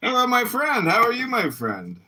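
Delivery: high, bright hello; sing-song greeting. Real, not acted.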